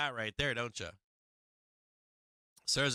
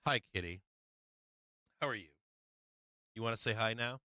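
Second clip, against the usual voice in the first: lower, softer aside to the cat; talking to the cat; 6 semitones lower, 5 dB quieter, faster than usual. Real, not acted. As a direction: coo quietly at the kitty. Greeting his cat mid-stream.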